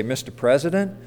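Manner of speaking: quiet, polite formal greeting; soft respectful address. Retold or imitated. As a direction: say it with deference. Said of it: "very respectfully say".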